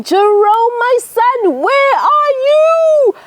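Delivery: high, loud panicked call, drawn out at the end; screaming dad. Acted. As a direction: scream for your son across the field. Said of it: "he was screaming and shouting".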